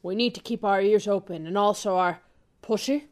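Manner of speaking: hushed low smooth-agent voice; suave spy. Acted. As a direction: play the cool spy, pitched well down.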